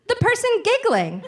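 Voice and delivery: put-on high, loud child's answer; loud insistence. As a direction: imitate a kid spelling out the obvious. Child insists to evasive dad.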